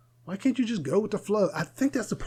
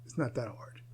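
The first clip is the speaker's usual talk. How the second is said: whispered aside; 6 semitones lower, 11 dB quieter than usual. Acted. Soft aside after advice.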